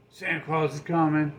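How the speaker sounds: normal talking voice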